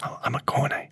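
hushed whisper. Retold or imitated. Gym people creeping up, whispering softly. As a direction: whisper it like a secret. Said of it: "people who've crept up to me saying".

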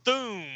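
high-pitched, drawn-out spoken boom; imitated boom. Giant steps on villains, imitated boom. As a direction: voice a comic-book impact noise. Sound effect of giant stepping.

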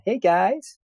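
mock high-pitched greeting; Jolene impression. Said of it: "I don't know if that's how she talks or not".

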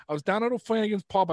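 high-pitched Boston pub-regular brag; Boston accent. Acted. YouTuber's troll character bragging about the pub, Boston accent. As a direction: boast like a Boston superfan. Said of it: "I legit talked in a shitty Boston accent".

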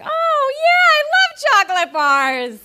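high, loud, gushing over chocolate; mock delighted. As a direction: gush like a kid offered candy. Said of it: "And I was like".

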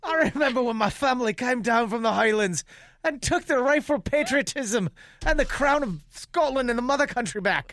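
high, put-on Scottish brogue, rolling with pride; a proud Scotsman boasting of the Highlands, in a Scottish accent. Improv player boasting of Scotland, high Scottish accent. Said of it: "You called him a Scottish woman".

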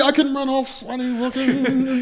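very high, laughing goofy voice; mock giddy Flash. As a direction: giggle like an overexcited kid. Mocking the Flash.

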